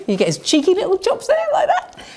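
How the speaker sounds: high, laughing at the end; cheeky giggle. Pretend. Presenter mocking the Donald Trump loo roll, giggling.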